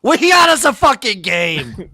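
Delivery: loud, slow, thrilled; excited shout. Real, not acted. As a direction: hype up the game. Reacting to the game.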